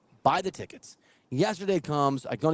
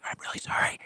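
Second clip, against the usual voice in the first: hushed retold apology; apologetic whisper; 7 dB quieter than usual. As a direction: whisper sorry while slipping out. Apologising to others while leaving.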